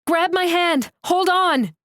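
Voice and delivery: high, loud rescue cry; urgent shout. Acted. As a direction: yell to the trapped child. Rescuing a buried child.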